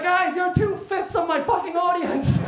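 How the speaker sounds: high, laughing disbelief at the crowd; laughing exasperation. Retold or imitated. Comic to his tiny audience, laughing and exasperated. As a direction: squeal it through laughter, outraged.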